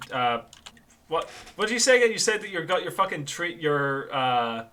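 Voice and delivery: normal talking voice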